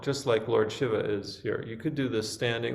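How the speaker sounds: ordinary delivery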